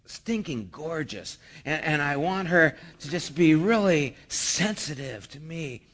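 put-on a single guy dreamily listing his ideal girl; dreamy wishing. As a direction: murmur it like a lovestruck guy daydreaming. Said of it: "the guy who says".

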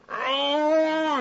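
strained high groan, teeth clenched; frustrated groan through closed teeth. Retold or imitated. Pastor showing his congregation a frustrated groan through clenched teeth. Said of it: "you're just".

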